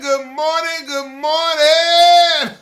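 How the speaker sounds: loud, high, drawn-out good morning; booming hype greeting; big morning greeting. Acted. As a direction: belt it out like a radio host. Loud radio-style morning greeting.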